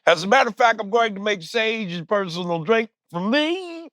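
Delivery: put-on high, drawling old-man Cosby voice; Bill Cosby impression. Mocking Cosby to the co-host, high and drawling. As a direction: do Cosby, smug and sing-song. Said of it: "Bill Cosby".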